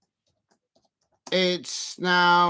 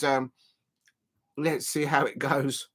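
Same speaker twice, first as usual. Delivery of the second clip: normal talking voice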